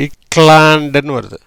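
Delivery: ordinary talk, nothing marked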